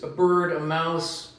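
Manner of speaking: ordinary delivery, nothing marked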